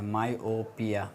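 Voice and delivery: Myopia stretched out slowly and quietly, like dictating a new term; slow spelled-out term. Shown as a demonstration. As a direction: say myopia slowly so students catch each syllable. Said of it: "myopia शायद बोलते हैं".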